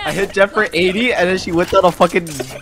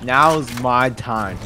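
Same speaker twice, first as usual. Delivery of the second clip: dramatic slow; 3 semitones lower, 4 dB quieter, much slower than usual. Acted. Echoes the catchphrase while gaming.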